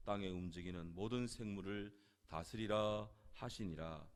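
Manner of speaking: loud, declamatory Bible reading; scripture reading. Acted. Preacher reads the creation verses to the congregation, loud formal scripture reading. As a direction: proclaim each verse in measured, emphatic phrases.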